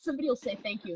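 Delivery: words spilling out through a real laugh, quick and high. Real, not acted. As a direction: laugh your way through it, fast. Laughing while telling a Midwest habit.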